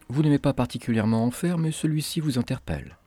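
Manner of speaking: usual speaking voice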